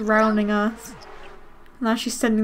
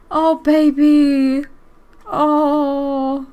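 tender coo; 4 dB louder, much slower than usual. Real, not acted. Aging dog on screen.

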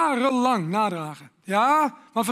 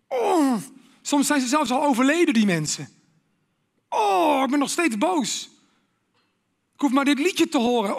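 higher and louder flares of resentment; mock angry outbursts; 5 semitones higher, 3 dB louder than usual. Preacher acting someone still furious at old hurts. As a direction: let the old anger flare up.

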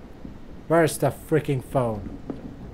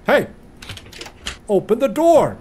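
demanding shout; 6 semitones higher, 4 dB louder than usual. Real, not acted. Locked in by the house.

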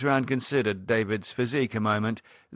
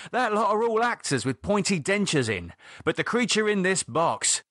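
higher-pitched, insistent scolding; David lecturing; 9 semitones higher than usual. Acted. David reminding Lydia the creature is no actor, insistent. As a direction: drive the point home to someone who keeps forgetting.

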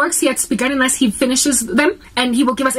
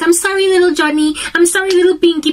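put-on high, louder baby-talk sorry; cooing apology to a child; 6 semitones higher, 3 dB louder than usual. Parent cooing an apology to an upset child, high baby talk. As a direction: coo like a parent soothing a toddler.

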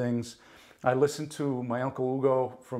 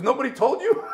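surprised; 12 semitones higher, 7 dB louder than usual. Retold or imitated. Wardrobe woman surprised on phone.

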